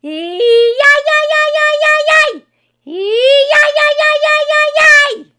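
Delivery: shrill high, loud ee-yi-yi screech; high screeching whoop. Acted. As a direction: screech it high and long like a wild man. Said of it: "kept a whooping and a screeching".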